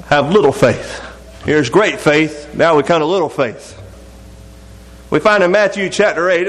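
ordinary talk, nothing marked

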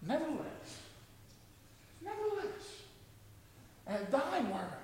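soft, hushed yielding; hushed whisper of trust after the outcry; quiet surrender. Pretend. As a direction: drop to a near hush and give in. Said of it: "you say".

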